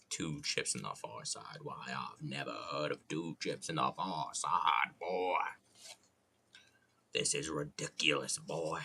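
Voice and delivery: low, folksy Southern indignation; Southern accent. Acted. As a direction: put on a low Foghorn-style Southern bluster. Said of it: "I've been in a southern accent mood".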